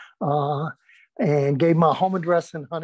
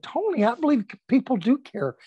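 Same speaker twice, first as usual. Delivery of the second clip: earnest pleading; 8 semitones higher than usual. Retold or imitated. Pleading with crying friend.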